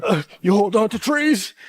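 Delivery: slow, mock survival-show host; Bear Grylls. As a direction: drawl it like a TV survivalist. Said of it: "I'm Bear Grylls".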